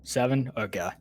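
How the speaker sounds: usual speaking voice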